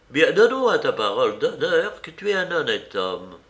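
a hint of insistent old woman; insistent old aunt. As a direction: press for a promise as an old lady. Old aunt demanding a promise.

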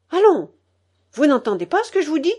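loud, high, fast scolding; impatient command. A narrator's reading. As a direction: press a disobedient child hard. Grandmother's impatient order, raised voice.

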